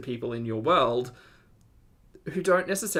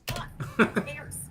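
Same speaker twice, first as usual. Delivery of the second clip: high breathy laugh. Real, not acted. Reacting to cam wars.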